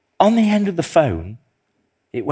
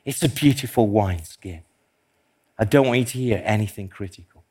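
soft, loving admiration, lower and quieter; voice of love; 6 semitones lower, 5 dB quieter, faster than usual. Retold or imitated. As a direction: speak it tenderly, like a loving father admiring. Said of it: "it was a voice of love".

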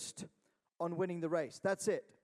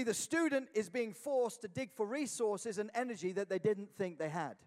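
ordinary talk, nothing marked

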